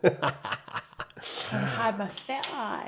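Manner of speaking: drawn out through a laugh. Real, not acted. Wife joking back to her husband on stream, laughing. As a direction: say it slowly, amused.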